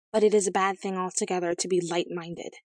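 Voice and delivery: ordinary delivery, nothing marked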